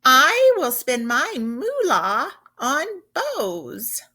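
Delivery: a lightly hammed-up cow saying moolah; cow character. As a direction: play the cow, proud of her new word. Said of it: "Carrie Cows says".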